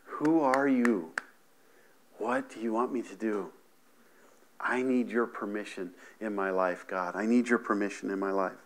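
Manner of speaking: whispered-down plea for permission; humble prayer. Pretend. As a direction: plead quietly to God. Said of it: "We're willing to say".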